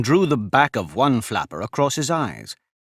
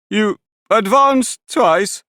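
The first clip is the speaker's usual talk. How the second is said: high, slow, louder question; Alice asking; 11 semitones higher, 6 dB louder, much slower than usual. A narrator's reading. Alice checks the dance step with the creatures, high and slow.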